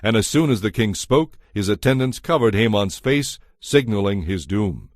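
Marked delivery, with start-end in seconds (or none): none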